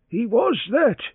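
high, deferential servant voice; humble old valet answering his master; elderly valet Parker. A narrator's reading. Valet Parker agrees eagerly.

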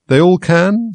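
brusque Duchess. A narrator's reading.

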